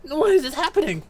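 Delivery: high-pitched helpless wail of a question; distressed captive. Acted. Trussed-up captive asking why this is happening, high distressed voice. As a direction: plead it in a high character voice. Voicing captive in game dialogue.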